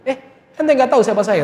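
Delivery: arrogant challenge voiced high in a put-on voice; the wife boasting her lineage to the in-laws, haughty; haughty mock wife. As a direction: demand to know if they know who she is.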